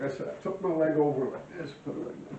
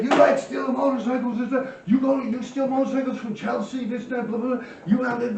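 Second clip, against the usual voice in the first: a hint of a threatening thug, higher; menacing gangster; 7 semitones higher than usual. Gangster confronting the bike thief, mock menace. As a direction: sneer the question at him.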